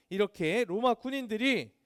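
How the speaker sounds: normal talking voice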